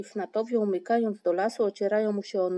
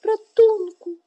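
high, loud wail for rescue; loud, plaintive distress call; desperate cry for help; 13 semitones higher, 14 dB louder, slower than usual. A narrator's reading. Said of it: "zawołał słabym głosem".

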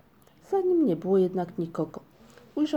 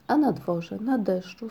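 ordinary delivery

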